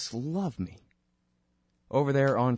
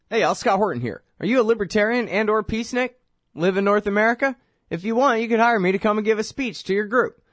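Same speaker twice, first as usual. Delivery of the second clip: higher, pitchman promo voice; upbeat ad read; 5 semitones higher, 4 dB louder than usual. Acted. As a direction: sell yourself like a radio spot. Reads his speaking ad.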